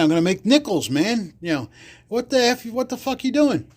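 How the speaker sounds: high, rising tough-guy challenge; mock mobster outrage. Patty from the Bronx bursts in, high and outraged.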